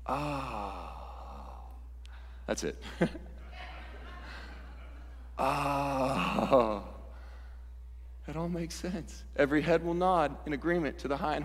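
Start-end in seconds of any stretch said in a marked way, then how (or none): 0.0-0.9 soft, long-held "ah" of realization; quiet sound of sudden insight; awed "ah". Acted. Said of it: "first words in heaven are gonna be this". 5.4-6.8 long breath of realization; acted sigh of wonder; awed "ah". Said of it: "first words in heaven are gonna be this".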